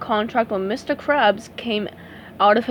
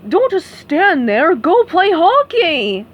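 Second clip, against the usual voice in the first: louder, higher, slow barking order; pushy shout; 7 semitones higher, 6 dB louder, slower than usual. A narrator's reading. Mister Krabs pushing SpongeBob out, loud order. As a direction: shove him out the door.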